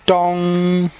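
mock bell chime; bell dong. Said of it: "strike the bell".

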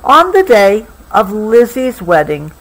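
usual speaking voice

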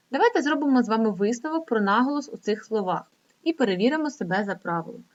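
ordinary talk

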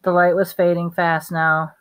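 usual speaking voice

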